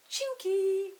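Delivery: high, loud call into the room. A narrator's reading. Peter calling for the pixie through the door, loud call. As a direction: call his name across the shed. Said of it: "he called".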